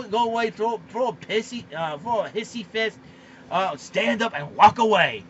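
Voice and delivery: usual speaking voice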